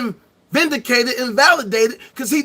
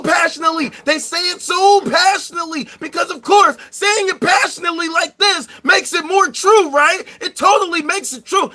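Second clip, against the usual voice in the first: mock passionate yelling; 7 semitones higher than usual. Shown as a demonstration. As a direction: yell it as if passion makes it true. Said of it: "if I say it like this".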